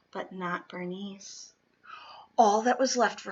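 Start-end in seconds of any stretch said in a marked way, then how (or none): 0.1-1.5 soft whispered refrain; a hushed, pitying whisper. A narrator's reading. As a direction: breathe it out, much softer. Refrain of the left-out bunny.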